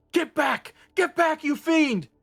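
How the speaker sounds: terrified shout. Acted. Sailor threatens with sword.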